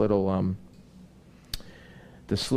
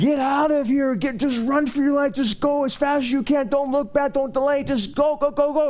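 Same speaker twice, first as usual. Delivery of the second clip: very high, rapid-fire commands to run; frantic urgency. Acted. Angel hurrying Lot out of the city, frantic. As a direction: push him out the door, no delay. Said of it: "Do you sense there's urgency in that?".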